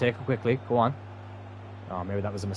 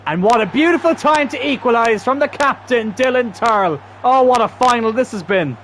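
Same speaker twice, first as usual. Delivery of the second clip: high, loud celebrating call; excited commentary; 9 semitones higher, 5 dB louder than usual. Real, not acted. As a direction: shout the equaliser like a commentator. Late equaliser in the final.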